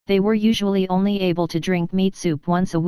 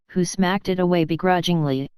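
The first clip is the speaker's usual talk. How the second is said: usual speaking voice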